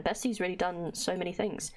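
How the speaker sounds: ordinary talk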